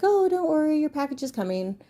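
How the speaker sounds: high sing-song customer service voice; mock the scammers' sweet reassurance. Mocking the scam company, high sweet reassuring voice. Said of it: "And they were like".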